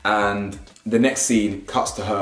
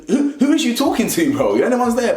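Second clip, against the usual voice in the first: incredulous; 12 semitones higher, 4 dB louder than usual. Real, not acted. Mocking the girls' talk.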